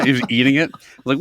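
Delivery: ordinary talk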